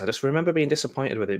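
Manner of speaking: ordinary talk, nothing marked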